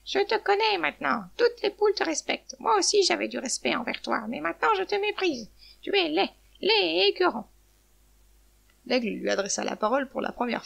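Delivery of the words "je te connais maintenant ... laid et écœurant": high-pitched, scornful outburst; insulting cry. A narrator's reading. Hen reviles the eagle anew, crying out angrily. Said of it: "Elle cria".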